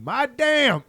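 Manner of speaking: loud, high "My damn" for Madame, as two words; mangled Madame. Retold or imitated. Comic imitating mom hollering at French teacher, mangled "My damn". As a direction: holler "My damn", hard American DAMN. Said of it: "she doesn't know that Madame is the prefix".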